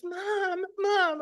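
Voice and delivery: put-on high pleading child's call; a child's high cry for mom; scared child calling. The boy runs home calling his mother, high-pitched.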